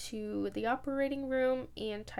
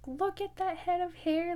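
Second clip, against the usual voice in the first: excited; 8 semitones higher, 3 dB louder than usual. Retold or imitated. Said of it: "they were so excited".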